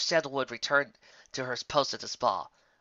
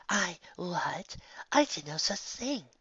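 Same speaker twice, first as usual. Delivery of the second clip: higher, slow indignant cry; shocked denial; 7 semitones higher, much slower than usual. Acted. Sandalwood protests her innocence, high and shocked. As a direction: protest in disbelief. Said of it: "exclaimed Sandalwood".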